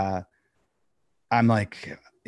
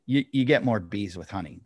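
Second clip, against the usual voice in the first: ordinary delivery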